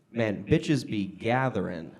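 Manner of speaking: usual speaking voice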